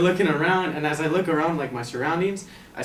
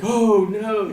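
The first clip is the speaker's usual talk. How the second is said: high, loud mock "oh, no"; hammed-up dismay; imitated shock; 6 semitones higher, 6 dB louder than usual. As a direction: play the other man's shock for laughs. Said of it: "this guy was shocked".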